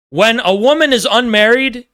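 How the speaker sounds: usual speaking voice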